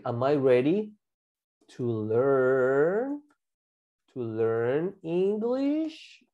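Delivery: drawn-out "learn" and "English", pauses between chunks; slow segmented modelling. Shown as a demonstration. Teacher models learning question for class, slow and stretched. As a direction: build it slowly, piece by piece. Teacher builds sentence piece by piece.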